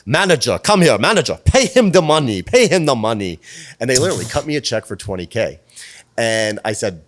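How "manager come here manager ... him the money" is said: put-on loud, high, bossy Russian accent; bad Russian-Ukrainian accent. Singer summons manager to pay up, loud mock Russian accent. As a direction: call out orders in a hammed-up Russian accent. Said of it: "this is gonna be the worst accent".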